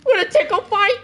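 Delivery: very high playful squeal; squeaky tickle fight. Retold or imitated. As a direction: squeal it like a giddy kid. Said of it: "Will Ferrell was like".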